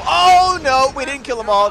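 loud, high "oh" easing down; alarmed outcry. Real, not acted. Player seeing the enemies are not dead, alarmed outcry. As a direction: cry out in sudden dismay.

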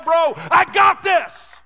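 slow, loud, high shout of assurance; God's promise shouted slowly to the crowd. Acted. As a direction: boom it out slowly as God. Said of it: "God looks at you and says".

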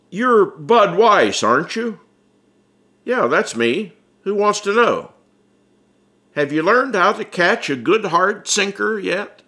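calling out from a shadowed car window; Ripley from the car. Acted.

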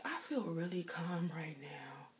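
unvoiced frightened whisper; patient whispering her fear to the nurse; scared whisper. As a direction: whisper it, barely holding it together. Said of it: "But I was like".

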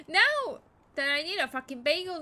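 furious loud outburst; frustrated shouting; angry shout. Real, not acted. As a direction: yell in frustration.

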